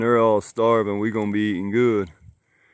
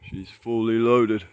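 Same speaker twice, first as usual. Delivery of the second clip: usual speaking voice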